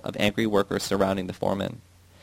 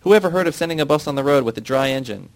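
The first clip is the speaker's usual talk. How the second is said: higher, louder indignant yell; outraged question; angry shout; 5 semitones higher, 5 dB louder than usual. A narrator's reading. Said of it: "the foreman shouted".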